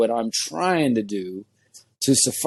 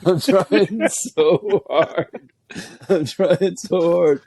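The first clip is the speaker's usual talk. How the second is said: helpless giggling, words stretched out; slow, high-pitched, real laughing fit; through laughter; 4 semitones higher, much slower than usual. Real, not acted. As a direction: struggle to talk while cracking up. Cracking up at own joke.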